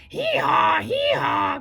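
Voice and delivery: loud high donkey bray. Acted. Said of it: "cried the donkey more loudly".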